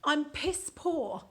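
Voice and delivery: slow, savoured delivery; very slow savoured phrase; relished phrase. Shown as a demonstration. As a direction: roll it out slowly with relish.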